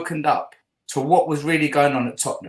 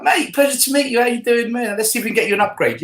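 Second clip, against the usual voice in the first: eager, higher-pitched greeting tumbling out; super excited; 5 semitones higher than usual. Retold or imitated. Fan greeting Martin Jol's brother with an upgrade offer, super excited. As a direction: gush as if meeting a hero's family. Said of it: "you know, super excited".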